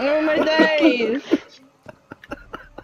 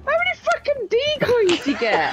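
exclaiming; 9 semitones higher than usual. Real, not acted. Shocked by decoys in game.

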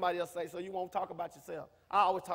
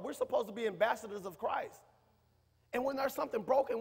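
ordinary delivery

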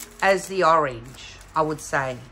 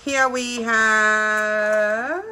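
very slow, with a long held HAVE; drawn-out reveal; 3 semitones higher, 4 dB louder, much slower than usual. Real, not acted. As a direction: stretch it like an unveiling. Presenting item from toolkit.

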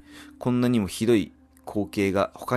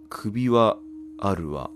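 ordinary talk

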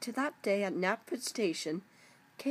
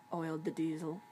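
ordinary delivery, nothing marked

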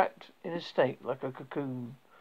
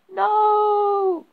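scream; 9 dB louder than usual. Acted. Sees snake enter daughter's tent.

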